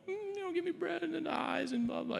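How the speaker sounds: whiny high begging-dog voice. Retold or imitated. Preacher mimics a dog begging for bread, high and whiny. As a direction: whimper it like a dog begging at the table. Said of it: "a dog begging at the table going".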